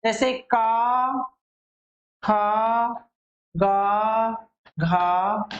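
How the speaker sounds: each consonant said slowly and apart; sounding out letters. Shown as a demonstration. Teacher to students, sounding out consonants slowly. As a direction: spell out का, खा, गा, घा one by one with a pause between. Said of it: "उदाहरण देके भी समझ रहे हैं".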